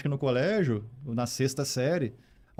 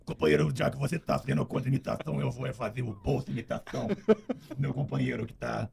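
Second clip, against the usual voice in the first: Lula impression; 5 dB quieter than usual. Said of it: "Faz o Lula e o Bolsonaro aí". (imitação do Lula)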